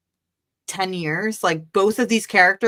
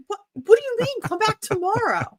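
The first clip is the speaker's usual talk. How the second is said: much higher, squeaky incredulous protest; a flustered kid who can't believe it; shocked disbelief. Retold or imitated. Retold disbelief at artist's reply.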